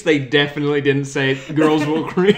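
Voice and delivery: loud, real laughing protest. Real, not acted. Correcting co-host on a lyric, laughing. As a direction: say it loudly through laughter. Cracks up over misheard lyric.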